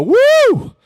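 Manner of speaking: loud, high "woo" cheer; celebratory whoop. Real, not acted. Cheering the cleared studio.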